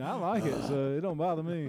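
ordinary talk, nothing marked

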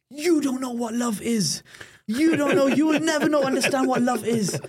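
put-on high, loud shouted teenage tantrum at the parents. Teen shouting at his parents after being caught, high loud tantrum. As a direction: yell it like a cornered teen lashing out. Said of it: "I'm just throwing a big tantrum".

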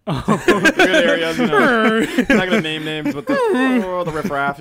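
high, loud, slow posh sneer with laughs; laughing mock snob; snooty mock voice. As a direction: sneer at the riffraff. Mocking snobby rich-area voice.

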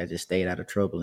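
plain reading voice

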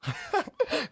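high startled burst of laughter; jump, then giggle. Real, not acted. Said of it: "You scared me".

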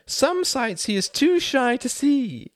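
high, slow, coy teasing; flirty woman. A narrator's reading. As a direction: tease him coyly. Greta flirting.

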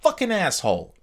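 high, scornful Fiona voice; mock Fiona. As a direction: storm out on Shrek. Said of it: "Fiona's like".

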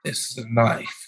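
a hint of Australian accent; Crocodile Dundee. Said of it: "Crocodile Dundee".

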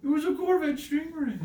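very high, loud protest breaking into laughter; laughing, outraged correction; exasperated insistence. Real, not acted. Insists again, amid laughter.

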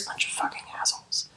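whispered under the breath; whispered curse. Real, not acted. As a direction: hiss it under your breath. Muttered insult under breath.

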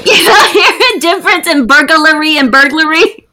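laughing in disbelief. Real, not acted. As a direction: giggle through the question. Laughing at friend's pronunciation.

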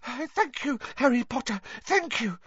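high, breathless little squeak; breathless elf. A narrator's reading. Dobby thanks Harry for stopping him, breathless. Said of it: "said Dobby breathlessly".

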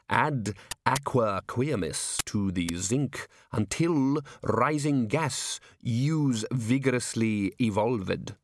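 put-on slow, measured reading of an old manual; half speed, each word weighed; archaic textbook recital. A narrator's reading. Old alchemy book giving instructions, slowly intoned.

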